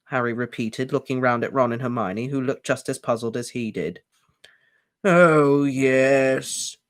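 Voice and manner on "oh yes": loud, drawn-out elf moan. A narrator's reading. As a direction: moan it in grief. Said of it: "moaned Kreacher".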